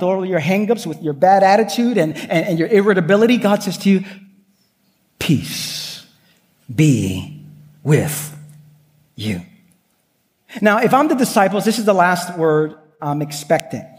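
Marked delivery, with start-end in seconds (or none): 5.2-9.4 soft, low, drawn-out blessing; tender blessing. Acted. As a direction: breathe it gently, word by word. God's reply to our failings.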